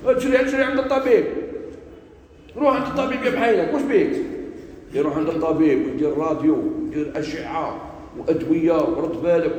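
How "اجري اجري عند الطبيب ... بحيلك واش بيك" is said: worried bystanders urging a friend on; urgent advice from others. Retold or imitated. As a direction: imitate people pressing someone to hurry. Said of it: "واش يقولوا له الناس؟".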